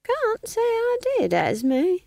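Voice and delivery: put-on high, slow, sly old woman; sly smiling innocence. A narrator's reading. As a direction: feign innocence through a smile, pitched high, slow. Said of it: "said Nanny, smiling at the sky".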